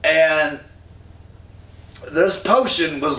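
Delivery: ordinary talk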